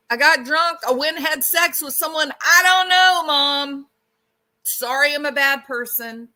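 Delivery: loud, high-pitched blurted confession; acted outburst of a guilty daughter; distraught confessing daughter. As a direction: blurt it out to Mom, upset. Acting a daughter confessing to mom.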